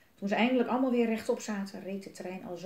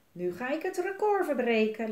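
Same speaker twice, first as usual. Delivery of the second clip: cheerful; 4 semitones higher, 5 dB louder than usual. Acted. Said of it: "lachte Elise vrolijk".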